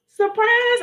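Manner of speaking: high, bright surprise call; spring the surprise on viewers; excited. Real, not acted. Host greeting live viewers, high excited surprise.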